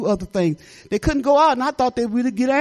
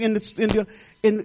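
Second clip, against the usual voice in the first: ordinary talk, nothing marked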